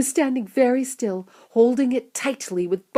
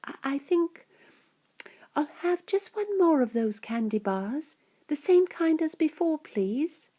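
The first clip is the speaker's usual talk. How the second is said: put-on hesitant, soft, higher child voice; quiet; 7 semitones higher, 5 dB quieter than usual. A narrator's reading. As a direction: ask softly and haltingly. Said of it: "he said quietly".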